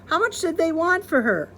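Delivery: high playful punchline; teasing husband; joking. Acted. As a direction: deliver the joke up high. Husband joking about wife.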